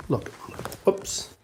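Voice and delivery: hushed whisper so as not to wake the dog. Real, not acted. Host shows viewers the sleeping dog, whispering. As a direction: breathe it out softly.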